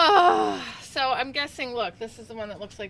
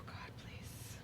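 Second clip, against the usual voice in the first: whispered prayer; 19 dB quieter than usual. Real, not acted. Praying before picking beans.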